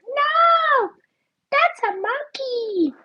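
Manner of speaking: sudden high-pitched outburst; high shout. Retold or imitated. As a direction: shout it high: no, it's a monkey. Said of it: "Oh, remember?".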